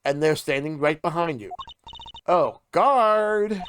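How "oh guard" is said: calling out. Acted. Calling out to guard.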